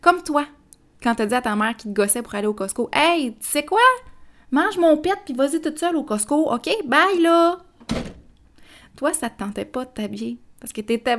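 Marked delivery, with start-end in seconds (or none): 2.9-7.6 loud, higher exasperated retort; telling mom off. Retold or imitated. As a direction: snap back at a nagging mother. Retold snapping at mom over Costco.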